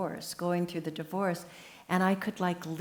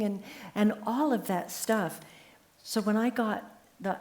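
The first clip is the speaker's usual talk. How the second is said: normal talking voice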